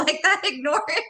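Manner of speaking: high, laughing self-parody; mock denial. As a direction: send up your own refusal, pitched high with a laugh. Mocks her own past denial.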